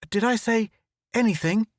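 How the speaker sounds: high sudden break-off; startled. Acted. Photographer spotting monsters, high startled break. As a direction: catch yourself in shock. Click startled mid-sentence.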